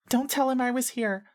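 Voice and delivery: put-on very high little-girl voice; frightened girl's plea. A narrator's reading. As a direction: plead in a small, high-pitched voice. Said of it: "she whispers".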